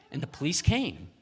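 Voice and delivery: ordinary delivery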